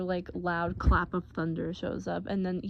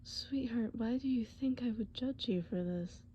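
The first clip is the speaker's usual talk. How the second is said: gentle, hushed comfort with long pauses; tender, reassuring boyfriend; 4 dB quieter than usual. Acted. Boyfriend to girlfriend scared of thunderstorms, tender reassurance. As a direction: soothe her softly, earnest and unhurried.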